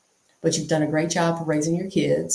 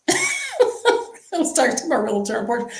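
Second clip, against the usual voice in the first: real laugh, much higher, words through the laughter; 13 semitones higher than usual. Real, not acted. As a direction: giggle while repeating it. Laughs retelling her own line.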